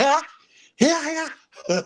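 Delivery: high mock yell imitating the baby. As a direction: squeal it out like a baby in a store. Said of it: "yelling and screaming".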